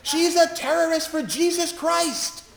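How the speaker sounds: high, loud accusing shout; retold shout. Man in the ER shouting accusations at the chaplain, loud and high. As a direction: yell the accusation across the ER. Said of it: "pointed at my colleague and shouted".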